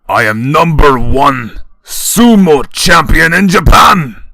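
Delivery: very loud, slow proud boast; drunken yell. A narrator's reading. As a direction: bellow it, drunk. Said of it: "Shoji yells".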